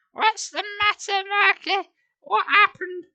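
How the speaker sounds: very high, slow mother's fuss; worried mum. Acted. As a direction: soothe a crying baby. Mum voiced, comforting.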